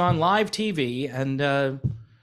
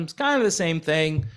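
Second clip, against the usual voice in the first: ordinary delivery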